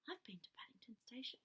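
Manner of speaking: quick unvoiced whisper; whispered aside. Real, not acted. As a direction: slip it in as a secret. Aside to listener.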